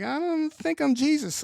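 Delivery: high, loud sarcastic quip; skeptical mock. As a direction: toss it off high and loud, unconvinced. Mocking reaction to Jesus claim.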